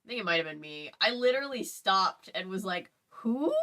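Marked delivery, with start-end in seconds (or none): 3.1-3.5 stunned. Retold or imitated. Re-enacting stunned reading reaction.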